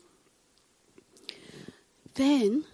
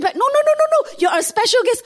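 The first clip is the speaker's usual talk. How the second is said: high, louder, pressing no, no, no; insistent; 13 semitones higher, 6 dB louder than usual. Retold or imitated. Pastor insists the guest sits in front, high and pressing. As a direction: refuse her refusal, pitched up and eager.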